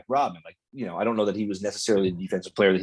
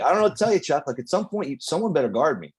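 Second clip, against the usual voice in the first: much higher, playfully innocent Bird; pitched-up fake innocence aimed at the coach; mock innocent gaslighting; 5 semitones higher than usual. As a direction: sound falsely puzzled, teasing the coach. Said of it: "Being like".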